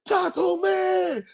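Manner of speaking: high, drawn-out heckling call; imitated yelling. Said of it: "Yelling at me".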